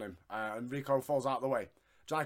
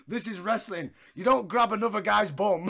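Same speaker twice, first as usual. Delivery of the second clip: laughing, louder and higher mock protest; mock ref complaint; 6 semitones higher, 8 dB louder than usual. Bubba scolding the ref for the bum grab, laughing loud protest. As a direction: bark it at the ref through laughter. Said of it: "Bubba turns to the ref and goes".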